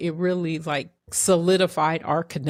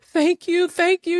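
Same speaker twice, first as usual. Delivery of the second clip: high, louder tearful thank you; retelling shaken gratitude, pitched up and louder; 11 semitones higher, 4 dB louder than usual. Retold or imitated. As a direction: pour out grateful thanks to the lady, voice up high. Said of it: "I'm like".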